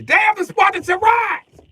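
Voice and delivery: high, loud shouted proclamation; mock battle cry. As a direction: cry it like a movie warrior. Mock war cry about sandals.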